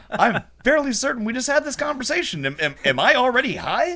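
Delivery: high, disbelieving retort; incredulous. Retold or imitated. Telling girlfriend in disbelief, pitched high. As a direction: act baffled at her forgetting. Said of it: "I was like".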